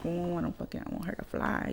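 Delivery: small, soft goody-two-shoes voice; hushed mock-innocent murmur. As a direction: imitate someone playing innocent, very softly. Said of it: "like, all innocent".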